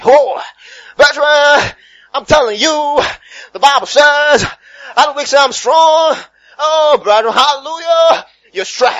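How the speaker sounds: high, loud, shouted revival preaching; hammed-up preacher at full force; fervent church preacher. Mimicking a preacher rousing the church, fervent shout. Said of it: "the tone I use, you know the kind of churches".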